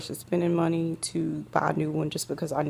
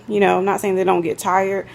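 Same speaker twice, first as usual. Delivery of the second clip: ordinary delivery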